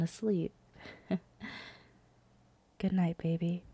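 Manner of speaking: hushed whisper beside a sleeper; soft, tender goodnight whisper; pillow talk. Acted.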